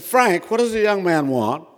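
high, loud voice of the wife; imposing wife. Retold or imitated. Wife questioning her husband about the young man, imposing. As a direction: pipe up loudly as the wife.

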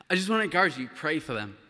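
ordinary delivery

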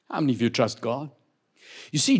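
ordinary talk

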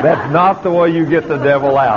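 chuckling through the words; laughing. Real, not acted. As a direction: laugh as you tell it. Laughing while retelling.

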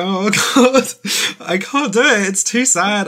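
laughing protest at being too moved; mock tearful overwhelm. Co-host retelling how the episode made them tear up, mock-overwhelmed. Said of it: "I actually completely teared up".

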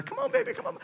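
put-on high cooing baby talk, coaxing a toddler; coaxing daddy. Daddy coaxing his toddler to walk, high cooing. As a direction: coax the baby to take a step.

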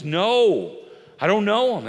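loud, hasty no; rash denial. Retold or imitated. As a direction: blurt it out to deny him. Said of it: "his rash response was".

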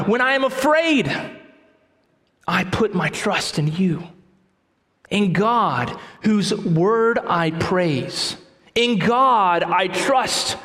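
slow, deliberate reading of the psalm, with long pauses; solemn scripture reading. Acted. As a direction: read each phrase like sacred text, unhurried. Reads psalm aloud in sermon.

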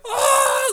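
very high-pitched imitated kid shriek; mock horrified scream. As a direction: squeal like kids losing their minds. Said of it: "everyone loses their mind".

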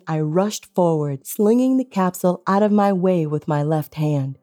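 ordinary delivery

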